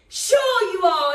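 loud slow call to the crowd. Acted. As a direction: belt it out slowly, high. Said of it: "And everyone say".

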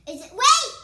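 sudden loud high scream. Real, not acted. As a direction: scream out wait. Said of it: "No screaming".

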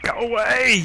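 sudden loud high yell; shouting go away. Real, not acted. Yelling at villagers to get out of his house. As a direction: bark them off, loud and high.

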